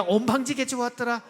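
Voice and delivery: loud Jeolla dialect cheers. Shown as a demonstration. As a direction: shout it out in a put-on Jeolla accent. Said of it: "전라도 버전 들어갑니다".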